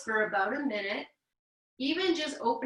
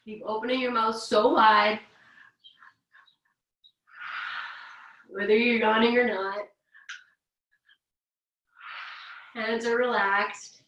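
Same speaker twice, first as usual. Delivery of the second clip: calm, unhurried instructor voice leading breaths; guided breathing. Acted. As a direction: guide the class gently through the yawn.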